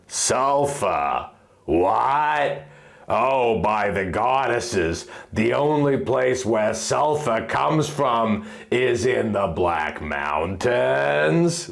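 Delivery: Lily taking in the news, low and slow with shock; shocked fairy Lily. Acted. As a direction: let the shock sink in slowly. Fairy Lily voiced in story.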